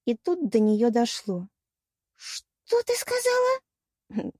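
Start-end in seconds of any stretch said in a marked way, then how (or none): 2.2-4.3 very high, slow, hushed shock; stunned disbelief. A narrator's reading. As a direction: let it dawn on you, drawing the question out. Mermaid suddenly realises what was said. (ошеломлённый вопрос)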